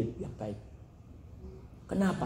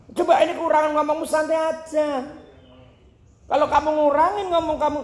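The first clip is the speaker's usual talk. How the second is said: mock nagging voice; 12 semitones higher, 4 dB louder than usual. Voiced advice continues.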